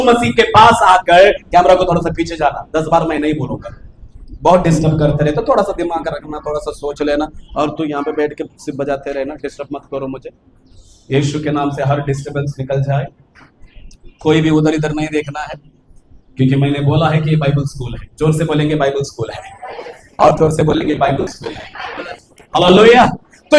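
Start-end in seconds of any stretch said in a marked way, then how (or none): 1.3-13.1 low, soft scolding aside to the cameraman; muttered aside. Real, not acted. 14.2-22.2 quick aside. Real, not acted. Quick low aside to congregation.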